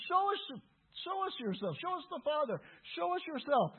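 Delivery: high-pitched, insistent demand; acted-out appeal from an eager follower; pleading disciple. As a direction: plead urgently, pitched well up.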